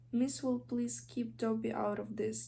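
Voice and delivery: ordinary delivery, nothing marked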